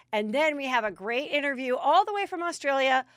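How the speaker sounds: normal talking voice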